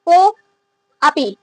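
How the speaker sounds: कोअपि split by a long pause after को; word read in parts. Shown as a demonstration. Teacher showing students the written word, कोअपि broken into को and अपि. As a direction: say कोअपि as two pieces, को, then अपि, softly stepping down. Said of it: "इसको को पढ़ेंगे".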